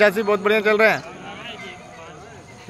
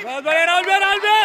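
cheering on; 7 semitones higher than usual. Real, not acted. Cheering a runner in race.

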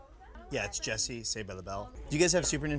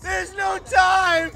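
high, slow, loud frantic yell; frantic shout; 6 dB louder, much slower than usual. Acted. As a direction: yell it in a panic. Sitcom meltdown parody, repeated.

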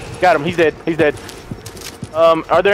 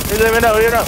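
ordinary talk, nothing marked

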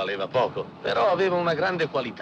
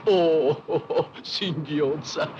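lento, ridacchiando sul singhiozza; ridendo; 2 semitones higher, much slower than usual. Acted. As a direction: scherno divertito, lento. Ride della moglie che singhiozza. (ride lentamente sul singhiozza)

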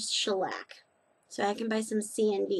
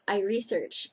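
usual speaking voice

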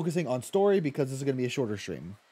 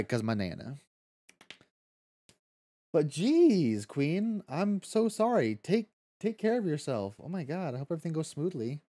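usual speaking voice

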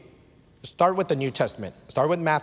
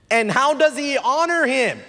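raised, louder pulpit questioning; emphatic preaching question; preacher's rhetorical question; 11 semitones higher, 6 dB louder than usual. Acted. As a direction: put it to the congregation high and loud.

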